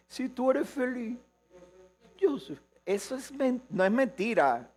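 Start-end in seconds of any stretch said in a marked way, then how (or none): 0.1-2.6 mock sweet devotion. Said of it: "Eso dice".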